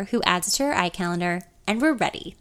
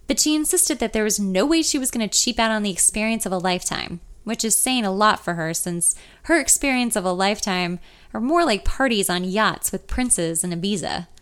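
plain reading voice

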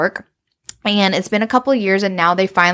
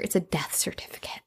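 whispered reveal, softer; 5 semitones lower, 13 dB quieter than usual. Acted. Simon reveals the certificate to Mina, whispered. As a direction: drop to a secret whisper. Dramatic reveal in retold scene.

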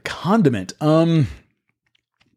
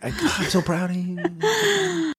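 mock teary; 7 semitones higher, 4 dB quieter than usual. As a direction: pretend to cry with pride. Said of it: "Oh my goodness, look at him".

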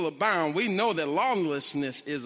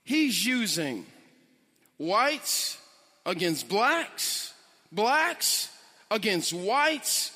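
ordinary talk

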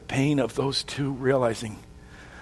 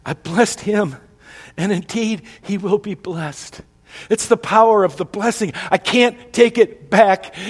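higher and louder, slowing down in anguish; anguished cry; 7 semitones higher, 6 dB louder than usual. Acted. Father cries out to his son, higher and louder. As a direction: cry out that it cannot be undone.

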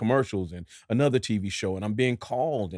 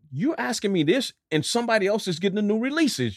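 exasperated; 11 semitones higher than usual. Retold or imitated. Inner exasperated thought.

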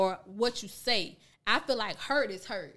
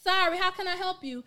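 put-on customer greeting; 8 semitones higher than usual. Acts out greeting at the door.

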